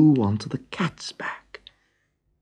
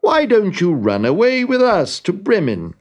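donkey inviting; 8 semitones higher, 3 dB louder than usual. A narrator's reading. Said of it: "replied the donkey".

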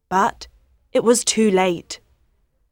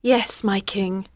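quiet; 7 dB quieter than usual. Acted. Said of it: "said Queen Isolde quietly".

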